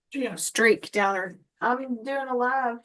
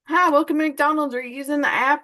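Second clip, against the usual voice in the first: cheerful drive-thru greeting; 5 semitones higher, 4 dB louder than usual. Retold or imitated. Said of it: "they're supposed to say".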